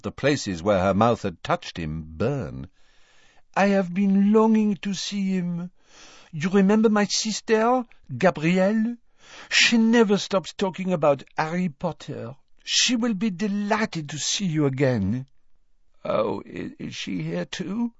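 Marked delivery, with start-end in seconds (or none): 3.5-15.3 put-on high, lilting French-accented woman; light French accent, gushing. Fleur's French voice. 16.0-17.9 hoarse, faint croak. Acted. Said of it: "Harry croaked".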